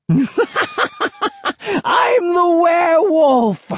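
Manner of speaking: very high, drawn-out manic shriek; wild, slow, high-pitched shout. A narrator's reading. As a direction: shriek it like a crazed hermit. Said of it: "the hermit shrieked".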